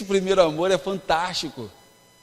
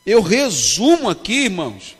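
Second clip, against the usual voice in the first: fervent preaching; 5 dB louder than usual. Acted. Pastor preaching to a youth congress, fervent and emphatic. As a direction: preach it to the crowd, building.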